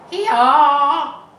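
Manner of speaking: high, very loud bleat; bleating lambs. Acted. Lambs answer the roll, very loud bleat. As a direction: bleat it out together. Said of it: "answered all the lambs".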